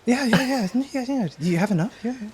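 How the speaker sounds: high-pitched, overlapping eager yeahs; eager background crowd. Acted. Crew answering the cook's offer, eager background crowd. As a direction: chime in like hungry onlookers. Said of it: "crowd in the background's like".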